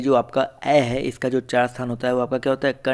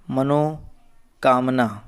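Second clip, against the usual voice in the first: drawn out pondering; much slower than usual. Real, not acted. Teacher pondering a spelling.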